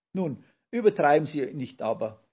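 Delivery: mildly squeaky, coy objection; flattered squeak. A narrator's reading. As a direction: pipe up, a touch flattered. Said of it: "piepste Brausewein geschmeichelt".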